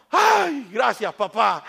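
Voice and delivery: high, loud burst of relief; relieved child. Acted. Freed boy thanking his father, loudly relieved. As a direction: gasp of thanks that drops in pitch.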